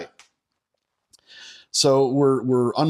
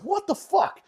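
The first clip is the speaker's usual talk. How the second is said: high-pitched disbelieving yelp; retold shock. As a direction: squeak it out in sudden disbelief. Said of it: "I'm like".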